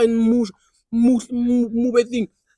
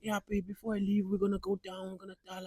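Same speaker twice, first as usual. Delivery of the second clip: much softer, trailing almost to nothing; soft girlfriend promise; 14 dB quieter than usual. Retold or imitated. Girlfriend reassuring him before she leaves, soft promise. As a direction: imitate a girlfriend's hushed assurance.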